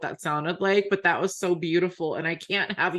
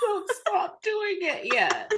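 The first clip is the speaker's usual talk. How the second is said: tearful plea; 11 semitones higher, slower than usual. Real, not acted. Pleading with friend, emotional.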